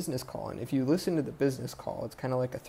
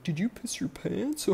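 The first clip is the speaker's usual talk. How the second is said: mocking; 8 semitones higher, slower than usual. Gang mocks the boyfriend.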